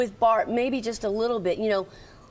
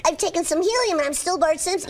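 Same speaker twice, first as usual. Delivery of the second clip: put-on higher helium-like boy voice; younger Bart; 6 semitones higher than usual. Shown as a demonstration. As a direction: squeeze it up high like helium. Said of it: "make him a little bit younger".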